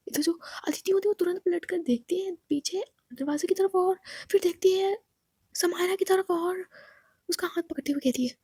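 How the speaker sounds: ordinary delivery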